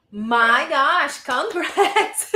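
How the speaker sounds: loud, laughing congratulations; thrilled congratulations. Real, not acted. Teacher congratulating a student, thrilled and laughing. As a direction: burst out laughing with praise.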